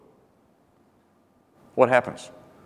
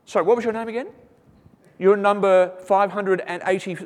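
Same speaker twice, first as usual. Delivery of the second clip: higher, absent-minded king; joking skit of a king greeting a wife; mock Solomon; 7 semitones higher than usual. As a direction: play the king who can't keep track. Solomon asking a wife's name.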